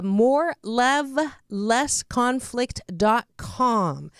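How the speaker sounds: slow, spaced-out web address; slow announcement. Real, not acted. Host giving listeners the guest's website, slow and deliberate. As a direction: spell it out slowly for listeners, word by word.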